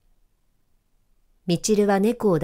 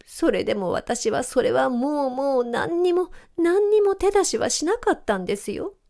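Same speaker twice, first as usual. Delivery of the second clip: high, whining plea of innocence; cat whimpering its innocence; whiny sobbing cat; 8 semitones higher than usual. A narrator's reading. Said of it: "しゃくり上げながら".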